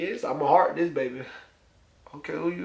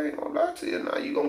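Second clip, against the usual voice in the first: whispered aside; 10 semitones higher, 8 dB quieter, much faster than usual. Real, not acted. Aside while swiping on Tinder.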